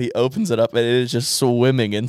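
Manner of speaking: ordinary delivery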